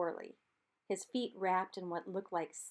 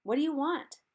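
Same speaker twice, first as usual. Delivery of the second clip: higher, louder call to the far bank; 7 semitones higher, 6 dB louder than usual. A narrator's reading. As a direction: call out to a voice in the dark. Charlie calls across the river.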